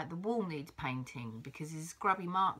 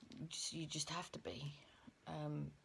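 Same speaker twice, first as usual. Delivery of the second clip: whispering; 4 semitones lower, 11 dB quieter than usual. Real, not acted. Trailing off, anxious, unvoiced.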